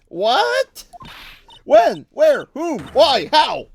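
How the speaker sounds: high, loud volley of questions; shocked questioning. Acted. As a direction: fire each word out as a startled cry. Sidekick shocked by friend's arrest.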